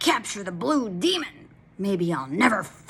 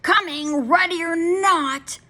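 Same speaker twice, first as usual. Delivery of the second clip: loud, higher, slow taunting call; yelling hide-and-seek call; 11 semitones higher, 7 dB louder, slower than usual. A narrator's reading. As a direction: call out to the hiding monster. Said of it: "yelling all the while".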